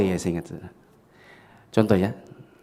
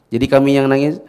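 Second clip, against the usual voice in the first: ordinary talk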